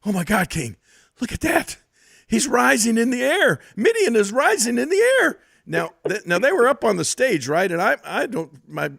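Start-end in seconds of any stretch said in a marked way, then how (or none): 0.1-5.4 excited announcer's cry, climbing high and loud; Michael Cole. Acted. Announcer calling a levitation live, mock-amazed high shout. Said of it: "all of a sudden Michael Cole goes".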